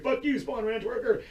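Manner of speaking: high, put-on jeering voice; mock hater jeer. Mocking a hater who jeers at him, high and sneering. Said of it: "they're like".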